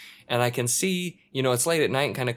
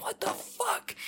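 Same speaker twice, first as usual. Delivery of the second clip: stunned; 9 dB quieter, slower than usual. Retold or imitated. Stunned reaction quote.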